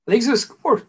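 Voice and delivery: forcing words out while choking; choking on a drink. Real, not acted. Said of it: "I think I'm choking to death".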